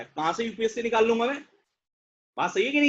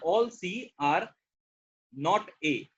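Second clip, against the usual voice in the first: "All C are not A" stretched out very slowly, pausing before "not A"; slow demonstration read; 2 semitones lower, much slower than usual. Shown as a demonstration. Teacher showing students how to read the sentence, very slowly. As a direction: drag each word out so students hear the structure. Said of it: "इस sentence को ऐसे पढ़ना है".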